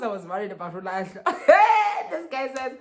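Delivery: ordinary delivery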